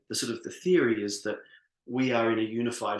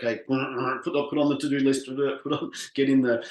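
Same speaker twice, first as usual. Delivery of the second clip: higher, louder, laughing rush through a to-do list; mock frantic busyness; 4 semitones higher, 8 dB louder than usual. Speaker mocking people rushing back to work, laughing mock frenzy. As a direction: send up your own hurried self. Mocking busy after-meditation self.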